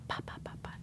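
voiceless whisper, almost silent. Shown as a demonstration. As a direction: breathe it out with no voice. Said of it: "sometimes y'all are really, like".

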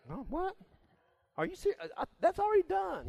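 high, incredulous guy voice; mock surprise. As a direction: act amazed anyone still cares. Guys already past it.

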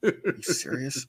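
high, mock exasperation; annoyed Joker. Joker complaining to his enemy, high mock annoyance. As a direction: pitch it up in weary disbelief. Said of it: "He was legit annoyed".

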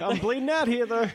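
high whiny complaint; mock wounded henchman. Wounded henchman left behind, high whiny complaint. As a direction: whine like a forgotten henchman. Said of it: "henchman voice".

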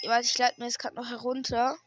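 usual speaking voice

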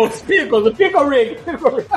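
high giggly repetition; laughing. Real, not acted. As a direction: laugh it out, delighted. Laughing on remembering.

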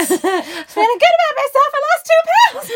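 very high, loud comic squeal; mock hurt wail. Student defends her looks to the teacher, high mock wail. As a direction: protest it in a squealing put-on voice. Mock wounded boast after the insult.

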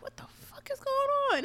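high, mildly alarmed reenactment; high-pitched mock bafflement; retold bewilderment. Retelling hearing noises at dawn.

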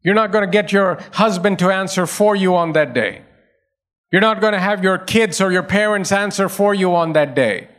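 usual speaking voice

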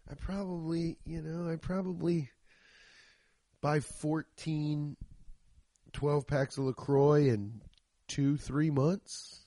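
usual speaking voice

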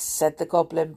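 ordinary delivery

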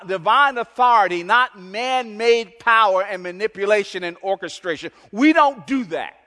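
high, loud, insistent preaching on every not and don't; preaching at full force. Acted. Preacher declaring how the kingdom works, full-force preaching. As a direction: thunder it out like a revival pulpit.

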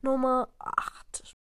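usual speaking voice

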